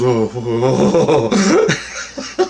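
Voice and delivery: slow run of imitated moans; hammed-up traumatised "oh" moans; distressed groaning. Buddy groans about alien visit.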